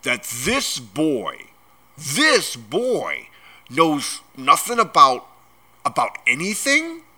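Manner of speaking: angry giant building to a high, incredulous finish; growled outrage. A narrator's reading. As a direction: build the indignation phrase by phrase. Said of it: "he growled at the Dursleys".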